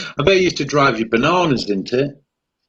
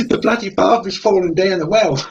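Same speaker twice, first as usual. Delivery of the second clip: put-on higher-pitched comic Australian accent; mock Australian; 6 semitones higher than usual. Relaying Skippy's news to co-host, mock Australian accent. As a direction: ham up a silly Australian. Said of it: "kind of like northeastern Australia".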